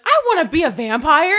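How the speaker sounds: high, loud laughing gush; mock-excited wish; giddy eager want. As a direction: beg it like a thrilled fan. Reporter retold eager.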